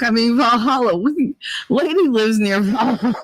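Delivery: normal talking voice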